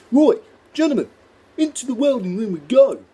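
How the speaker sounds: rally the class like a booming workshop teacher; high, loud "Right, gentlemen" called to the class; college tutor impression. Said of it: "My name's Lee, [name]".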